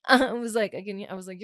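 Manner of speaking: normal talking voice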